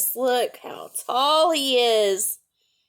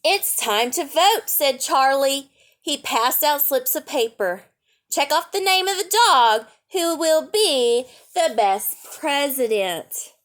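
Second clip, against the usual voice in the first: bright read-aloud storytelling voice; lively picture-book reading; story narration; 3 semitones higher than usual. A narrator's reading.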